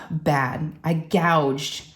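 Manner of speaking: ordinary delivery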